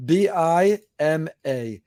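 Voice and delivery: letters B I M A spoken one by one, slowly; spelled out slowly. Shown as a demonstration. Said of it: "code is".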